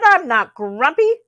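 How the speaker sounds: high, loud huffy denial; Rabbit voice. A narrator's reading. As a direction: deny it like a sulky rabbit. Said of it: "said Rabbit".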